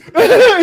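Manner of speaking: short loud laugh; bitter laugh. Real, not acted. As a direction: burst out laughing. Laughs at the situation.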